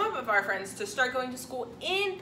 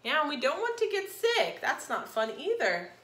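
usual speaking voice

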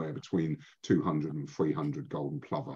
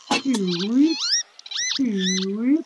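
high rising peewit call, louder; lapwing cry; 5 dB louder, much slower than usual. Shown as a demonstration. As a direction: whistle it up like a lapwing. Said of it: "has a wonderful call".

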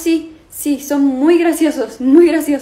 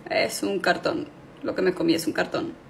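dismayed; 7 semitones lower, 10 dB quieter than usual. Acted. Disappointed discovery.

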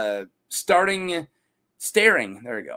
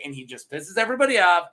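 normal talking voice

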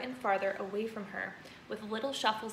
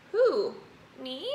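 puzzled; 3 semitones higher, 5 dB louder than usual. Acted. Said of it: "looking genuinely puzzled".

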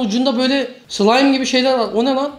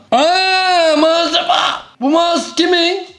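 surprised shout; 8 semitones higher, 3 dB louder, much slower than usual. Real, not acted. Surprised shout at dirty mouse.

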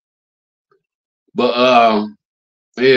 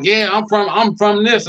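higher, mocking imitation of a tough-guy claim; mock the boast, pitched up and cocky; mocking street claim; 8 semitones higher than usual. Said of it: "be trying to claim".

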